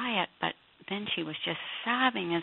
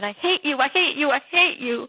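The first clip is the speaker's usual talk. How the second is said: higher, louder, a pained cry of I hate you; retold self-hatred; 9 semitones higher, 10 dB louder than usual. Woman shouting at herself in the mirror, pained and loud. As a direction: shout it at your own reflection, higher and louder. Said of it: "I looked in the mirror and I said".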